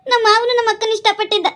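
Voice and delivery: high-pitched, upset reproach; hurt accusation. Acted. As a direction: lash out at your husband, hurt. Upset wife accuses husband in quarrel.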